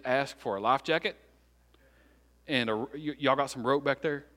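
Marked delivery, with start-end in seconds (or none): none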